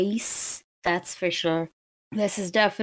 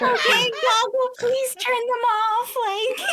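high, squeaky voice through laughter; laughing plea. Acted. Player pleading with the smart mirror, laughing. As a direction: beg while cracking up. Begging assistant while laughing.

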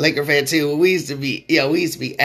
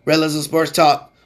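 ordinary talk, nothing marked

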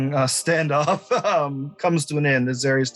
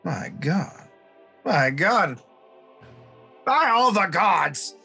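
astonished, rising higher; shocked; 5 semitones higher, slower than usual. Acted. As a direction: gasp out in disbelief. NPC reacts to news.

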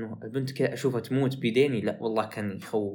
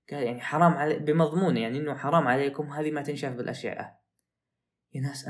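usual speaking voice

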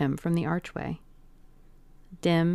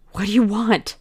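a startled, frightened gasp of a question, louder; 3 semitones higher, 6 dB louder than usual. Acted. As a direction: blurt it out, caught off guard. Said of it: "he gasped".

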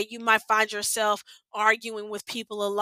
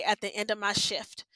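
normal talking voice

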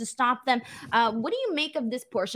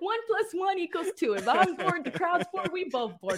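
laughing through pain; 7 semitones higher than usual. Real, not acted. Repeating joke while laughing.

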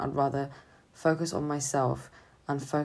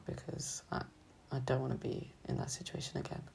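softer whispered words, drained; real whisper; 10 dB quieter than usual. Real, not acted. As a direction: whisper it as if afraid to say it aloud. Recalling feeling numb.

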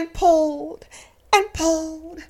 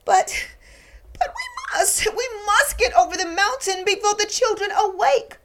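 higher, louder insistent plea; urgent toys; 7 semitones higher, 4 dB louder than usual. Acted. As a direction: press them, it's urgent. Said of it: "said all the dolls and toys".

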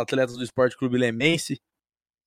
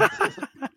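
high laugh; 7 dB quieter than usual. Real, not acted. Host bursts out laughing.

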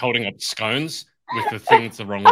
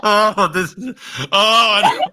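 laughing; 8 semitones higher, slower than usual. Real, not acted. Helpless laughter.

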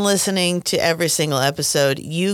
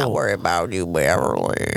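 much lower, creepy quote from It; creepy movie dad; 12 semitones lower than usual. Retold or imitated. Quoting Beverly's creepy dad from It in a deep voice. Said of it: "Uh, It".

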